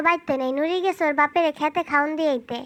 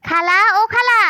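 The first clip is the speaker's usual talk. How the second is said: calling out; 7 semitones higher, 6 dB louder than usual. Acted. Calling aunt from outside.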